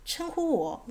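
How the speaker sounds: high-pitched puzzled echo question. A narrator's reading. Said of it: "这声音困惑地说". (困惑地高声反问)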